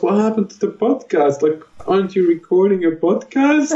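put-on high, suspicious little-girl voice; child's puzzled question. Daughter Sarah questioning her dad, high and puzzled. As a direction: ask it like a wide-eyed kid. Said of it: "She goes".

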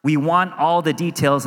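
ordinary talk

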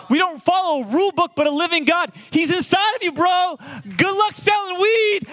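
very high, exuberant crowing; gleeful retold taunt. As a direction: celebrate at the kid in a high-pitched voice. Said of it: "laughing my brains out".

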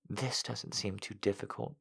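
hushed inner voice; inner thought. A narrator's reading. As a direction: think it quietly to herself. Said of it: "she thought to herself".

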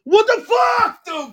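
high, drawn-out indignant yell; outraged shout. Real, not acted. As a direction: holler it in disbelief at your buddy. Friend refuses to run plays.

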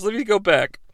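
ordinary talk, nothing marked

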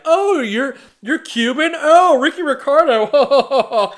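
high, slow, gushing party guest voice. Retold or imitated.

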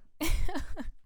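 short sheepish laugh; embarrassed laugh. Real, not acted. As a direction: laugh it off lightly. Apologetic laugh after apology.

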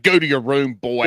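mock scold, like sending a kid to his room; mock stern parent.

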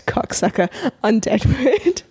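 normal talking voice

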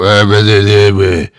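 loud mumbled blah-blah noise; mock mumbled answer. People asked their name by the host, mock mumbling. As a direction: imitate someone garbling their own name. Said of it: "I say, "What's your name?" And they go".

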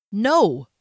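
loud, sharp cut-off no; captain cuts her off loudly; harsh. Acted. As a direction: bark the word to stop her. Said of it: "Derry said harshly".

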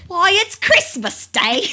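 high, loud, slow urchin cry; hammed-up street boy announcing great news; turkey boy. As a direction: stretch it out in a piercing boy's yell.

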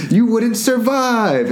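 high, drawn-out mock pronouncement; mock melodrama. Maid answering Macy's question about dying, high and melodramatic. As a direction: stretch it out high, hamming it up.

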